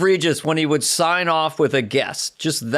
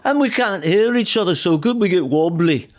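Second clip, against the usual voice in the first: McCartney impression; 4 semitones higher than usual. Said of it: "I like being [name]".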